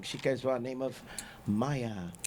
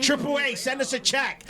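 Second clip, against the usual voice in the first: higher, louder shout-out to Triple A; calling out; 9 semitones higher, 3 dB louder than usual. Real, not acted. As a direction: call out to the sponsor. Calling out to sponsor.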